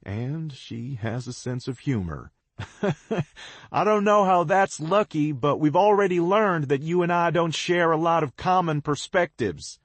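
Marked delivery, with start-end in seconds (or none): 2.6-3.5 bark of laughter. A narrator's reading. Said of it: "The bark of laughter". 3.7-9.8 joking back. A narrator's reading. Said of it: "I joke back".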